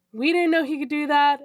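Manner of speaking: ordinary delivery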